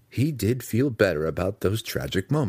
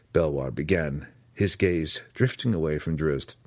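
ordinary delivery

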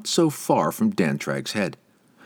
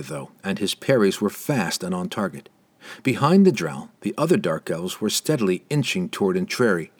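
ordinary delivery, nothing marked

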